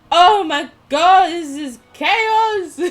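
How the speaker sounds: loud, high, drawn-out exclamation; overwhelmed outcry. Real, not acted. Player overwhelmed by chaos in the game, loud outcry. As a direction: wail it over the mayhem.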